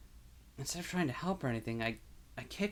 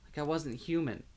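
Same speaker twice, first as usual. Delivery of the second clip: tearful; 4 semitones higher than usual. Acted. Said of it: "my voice cracks on a sob".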